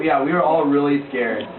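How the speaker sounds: usual speaking voice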